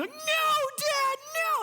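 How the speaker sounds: very high wailing plea; hammed-up high-pitched begging; mock panicked kids. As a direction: imitate children squealing in terror. Terrified kids begging their dad.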